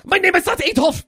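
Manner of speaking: loud, high mock German protest; German accent. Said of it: "I'm doing a German accent".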